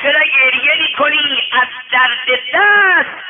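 loud, high, drawn-out questioning; acted ruler's shouted question; sultan's demand. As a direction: call the question out forcefully. Sultan questions the weeping student.

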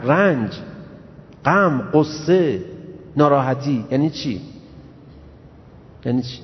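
ordinary delivery

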